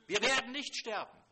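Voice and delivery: "Wir werden nicht sterben" cried out loud and high; fervent proclamation. Acted. Habakkuk declaring faith to God, loud cry. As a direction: proclaim it loudly, full of faith. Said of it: "Er sagt dann im Vers zwölf".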